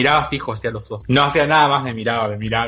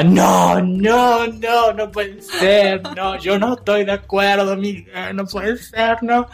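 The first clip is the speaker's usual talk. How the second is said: mock-dramatic mother, pitched higher; dramatic mom protest; 7 semitones higher, much slower than usual. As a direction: ham up the outraged mom refusing. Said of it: "todo el drama".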